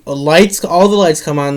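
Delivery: usual speaking voice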